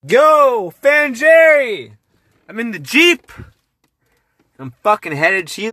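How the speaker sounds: loud, high, hyped yelling; excited shouting. Real, not acted. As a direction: yell it out, full of hype. Calling out from the Jeep.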